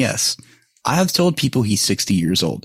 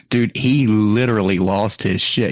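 normal talking voice

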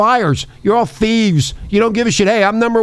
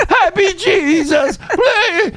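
high, laughing caricature shout; laughing mock voice; 12 semitones higher, much slower than usual. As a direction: cackle through a silly voice.

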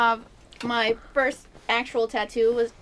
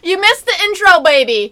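loud, higher teasing call; 9 semitones higher, 16 dB louder than usual. Real, not acted. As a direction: tease him as he comes in. Calling to someone arriving late.

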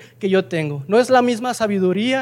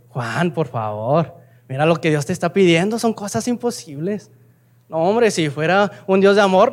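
tempting devil; 4 semitones lower than usual. Acted. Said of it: "El diablo llega y le empieza a decir".

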